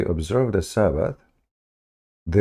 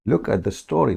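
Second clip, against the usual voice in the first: usual speaking voice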